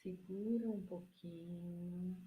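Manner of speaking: very slow, soft, drawn-out instructions; guided breathing meditation. Real, not acted. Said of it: "Eu vou ter que mudar meu tom de voz". (meditação guiada, voz lenta e suave)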